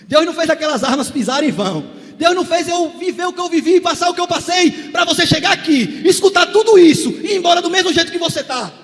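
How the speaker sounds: loud, high preaching shout; fiery preaching. Acted. Preacher rebuking addiction before the church, shouting. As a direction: rebuke it with full force.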